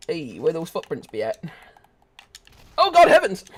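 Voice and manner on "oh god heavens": high loud startled yelp; startled cry. Real, not acted. Streamer ambushed in game, high startled yelp. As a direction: jump out of your skin.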